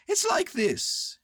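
high, slow bear voice; Winnie the Pooh. Acted. Bear beginning to explain, high slow voice.

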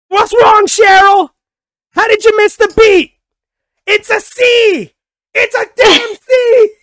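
very high, loud hammed-up shout; yelling parent. Parent berating Cheryl over a missed beat, mock screaming. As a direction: scream it like a parent at cheer practice, stretching the last words. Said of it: "just yelling at his son".

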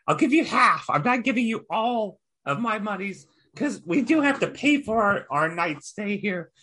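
high-pitched goblin haggling. Acted. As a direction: play the goblin high and tight. Goblin player voicing his character.

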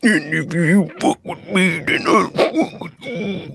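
high, slow mocking voice; mocking impression. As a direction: parody his threat in a squeaky voice. Mocking him in a put-on voice.

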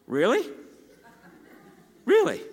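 a prodding voice of disbelief; voice of the Holy Spirit. Retold or imitated. The Holy Spirit nudging the preacher in the car, incredulous.

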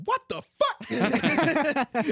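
high, slow yell through laughter; laughing shout. Retold or imitated. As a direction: belt it out laughing. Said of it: "let him just go".

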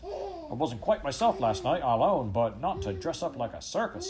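acted working-class London complaint; protesting street seller. A narrator's reading. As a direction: protest with comic indignation. Bootlace seller protesting to crowd.

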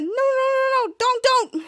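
high, rapid-fire acted protest; mock panicked pleading. Muchacho facing a sweaty hug, high mock pleading. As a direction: beg him off in pretend panic.

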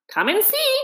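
delighted. A narrator's reading. Rat delighted.

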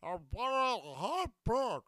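loud, high, garbled throaty caricature; exaggerated phlegm. Shown as a demonstration. Comedian exaggerating the questioner's demo, loud high phlegmy garble. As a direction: push the phlegmy challenge into near gibberish. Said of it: "the more you phlegm it up".

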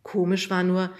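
ordinary delivery, nothing marked

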